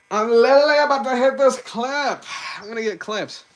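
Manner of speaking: frantic, high cartoon hero outburst; Sonic. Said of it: "Say something so out of pocket as Sonic".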